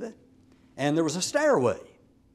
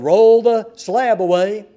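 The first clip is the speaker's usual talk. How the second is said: slow, much louder order; loud royal command; 4 semitones higher, 10 dB louder, slower than usual. Acted. King at dawn ordering servants to open the pit, slow loud command.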